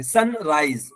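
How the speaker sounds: "सनराइज" ending in a voiced Z. Shown as a demonstration. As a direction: say "सनराइज" as "sun-raiz". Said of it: "हम यहाँ पर जा से लाएंगे". ("सनराइज" में ज़ा की आवाज़)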